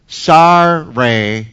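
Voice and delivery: low, sullen, clearly insincere sorry; grudging fake sorry. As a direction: mumble it like a boy forced to apologise. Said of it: "He's not".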